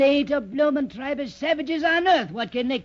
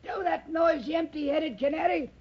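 angry; 6 semitones higher than usual. Acted. Old sailor hushes the parrot.

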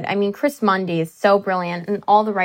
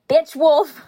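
higher-pitched, punched-out character line; character zinger; 6 semitones higher, 4 dB louder than usual. Pretend. Actress imagining saying it to her husband, punchy zinger. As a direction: throw it out as a zinger.

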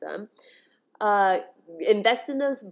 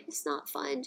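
it's not fun, softer and higher, after a laugh; softer emotional repeat; 5 semitones higher, 7 dB quieter than usual. Real, not acted. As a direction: let it slip out softly, half laughing. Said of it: "I'm getting emotional".

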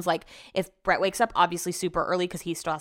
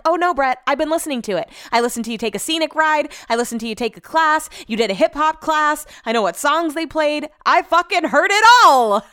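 louder, higher exasperated rant at Brett; exasperated retort; 7 semitones higher, 7 dB louder than usual. Retold or imitated. Telling her husband she heard his whole class. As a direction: let him have it, building to the swear. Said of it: "I'm like".

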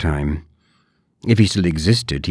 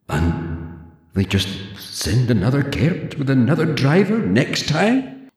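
usual speaking voice

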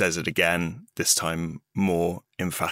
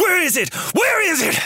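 much higher and louder, furious demand; raging shout; 5 dB louder than usual. Acted. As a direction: yell it in a blind rage. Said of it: "I immediately rage".

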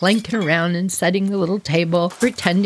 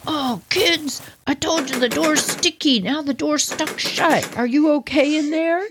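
worried dad; 8 semitones higher than usual. Retold or imitated. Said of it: "he goes".

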